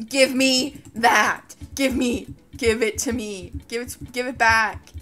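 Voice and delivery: loud insistent demand, drawn out at first. Real, not acted. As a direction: grab for it, demanding it back. Grabbing for loot, demanding.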